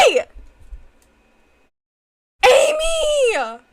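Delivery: shrieking Amy's name; excited scream. Real, not acted. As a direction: scream it out, thrilled.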